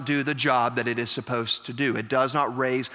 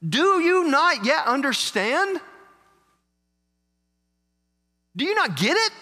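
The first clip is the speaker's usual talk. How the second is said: exasperated; 12 semitones higher than usual. Acted. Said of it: "And he said to them".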